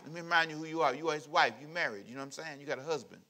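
normal talking voice

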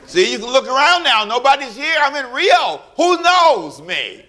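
hammed-up sneaky glee; mock tempted. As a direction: play the tempted man looking around. Joking temptation aside.

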